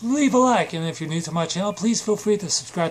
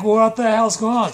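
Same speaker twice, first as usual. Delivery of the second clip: louder, higher mock yell; confused shout; 4 semitones higher, 7 dB louder than usual. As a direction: yell in disbelief at the chaos.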